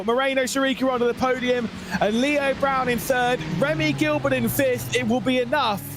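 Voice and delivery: high, fast-paced play-by-play call; live race commentary. Acted.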